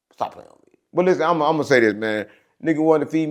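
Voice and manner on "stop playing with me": breathy whisper, no voice; whispered aside. Real, not acted. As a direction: drop to a whisper: stop playing. Aside after story punchline.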